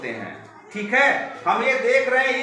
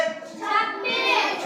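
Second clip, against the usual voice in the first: long, high, drawn-out prompt; drawn-out call; 12 semitones higher, 4 dB quieter, much slower than usual. Shown as a demonstration. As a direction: stretch "छब्बीस" up high so the class joins in. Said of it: "बोलो जोर से". ("छब्बीस" को ऊँचे सुर में खींचकर बोलो)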